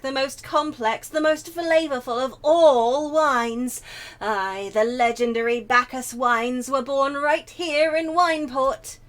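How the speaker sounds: theatrical, rhapsodic character voice; carried-away enthusiasm. Acted. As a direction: proclaim it with swelling pride. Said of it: "pardon my enthusiasm".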